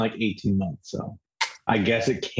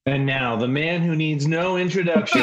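big announcer build-up, pitched up; hype man intro; 5 semitones higher than usual. Pretend. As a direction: hype up the crowd like an MC. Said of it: "we would need a hype man".

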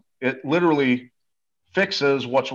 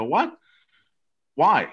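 higher-pitched, startled retold reaction; surprised questioning; 5 semitones higher than usual. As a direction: act out being taken aback. Retold startled reply to friend's news.

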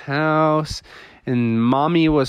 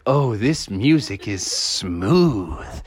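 slow, lower, savoring drawl; soothed dad; 4 semitones lower, much slower than usual. Acted. Dad waking to the melody, soothed. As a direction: stretch out the last word blissfully. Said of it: "Froggy's dad was like".

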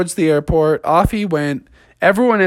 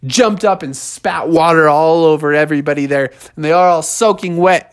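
usual speaking voice

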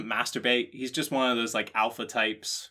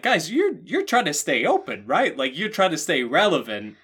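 higher, louder pleading at the library staff; mock exasperated plea; 5 semitones higher, 5 dB louder than usual. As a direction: beg them to be relevant, exasperated.

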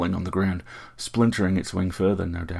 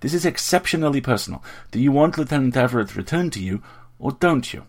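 louder, higher, exasperated scolding; an exasperated sorceress demanding a straight answer, louder and higher; huffy; 6 semitones higher, 6 dB louder than usual. A narrator's reading. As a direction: huff at him and press for an answer. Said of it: "she huffed and went on".

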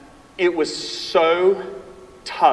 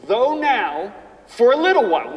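louder, rising "Though now", punch "little"; emphatic scripture reading; 6 semitones higher, 6 dB louder than usual. Shown as a demonstration. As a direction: preach each word. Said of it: "listen to these words".

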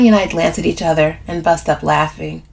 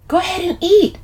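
higher-pitched gentle urging; 13 semitones higher than usual. A narrator's reading. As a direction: urge them on in a higher voice. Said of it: "I urge".

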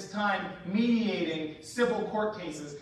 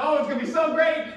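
eager parent; 6 semitones higher, 5 dB louder than usual. Retold or imitated. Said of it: "and you're like".

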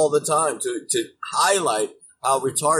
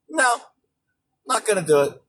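condescending refusal; 6 semitones higher than usual. Retold or imitated. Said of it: "dripping with condescension".

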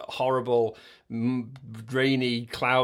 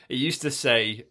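ordinary talk

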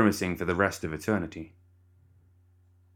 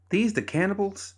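high-pitched question; 13 semitones higher than usual. Acted. Surprised question at strangers.

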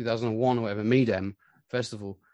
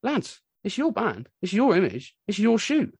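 photographer's pep talk; 9 semitones higher than usual. Retold or imitated. Photographer telling the band to stand up to the label, higher. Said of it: "And he went".

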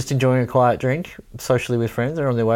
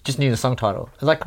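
ordinary talk

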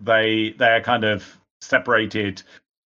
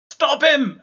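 very high, loud screamed order; 6 dB louder than usual. Acted. Dagmar orders her men to stop the accuser, screaming. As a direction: scream the command out at full pitch.

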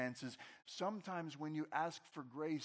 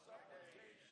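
congregation response; 17 dB quieter than usual. Real, not acted. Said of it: "Everybody say the word supplication".

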